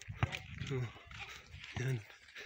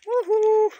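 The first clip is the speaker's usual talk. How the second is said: excited whoop; 9 dB louder than usual. Real, not acted. Cheering on the trail.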